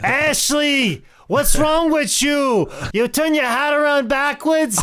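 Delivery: high, loud Italian mama voice; hammy Italian aunt shouting; Italian relative accent. Pretend. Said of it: "if I did an Italian accent".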